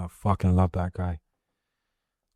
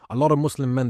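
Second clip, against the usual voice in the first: usual speaking voice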